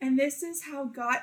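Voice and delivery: a hint of a prim churchy tone, laughing through it; pious mock voice. Mocking a friend's solemn video message, half-laughing. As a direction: parody her solemn video voice. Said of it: "it didn't even sound like you".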